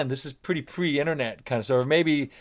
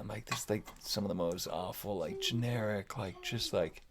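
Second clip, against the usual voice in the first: retold disdain; 2 semitones lower, 9 dB quieter than usual. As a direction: grumble it quietly under your breath. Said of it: "I was hating it in the verse".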